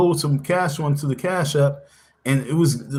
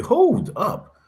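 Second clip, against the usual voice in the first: surprised hold up; 9 semitones higher than usual. Retold or imitated. FBI agents stunned by story.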